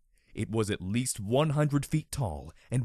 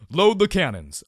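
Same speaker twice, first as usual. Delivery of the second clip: loud, higher command; barked order; 6 semitones higher, 9 dB louder than usual. A narrator's reading. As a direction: bark the order at the private. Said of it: "I ordered her".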